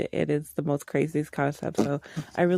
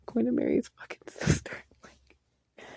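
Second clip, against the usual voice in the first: whisper; 7 semitones higher than usual. Real, not acted. Gossiping shocking plot twist.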